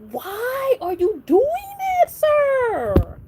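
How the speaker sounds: high indignant scolding of Lestat; outraged squeal. Real, not acted. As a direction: squeal at him in disbelief.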